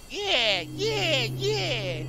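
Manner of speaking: high, thrilled triple yeah trailing off; excited yeah. Real, not acted. Streamer cheering a win, high and thrilled.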